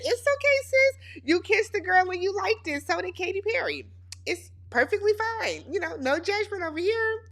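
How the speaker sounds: high-pitched, light sisterly comforting; playful reassurance. Pretend. Recapper telling Cassie the kiss is fine, playful reassurance.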